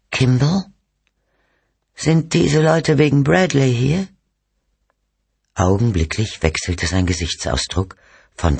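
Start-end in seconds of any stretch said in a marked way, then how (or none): 0.1-4.1 high, frail woman's call; weak voice. A narrator's reading. Sick wife calls to husband about the visitors, weak and high. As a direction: call out weakly, pitched well up. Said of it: "eine schwache Stimme rief".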